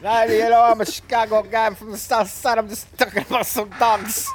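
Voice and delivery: hammed-up Chicago guy. Keeping up the Chicago impression for the friends. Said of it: "normal guy on the street in Chicago".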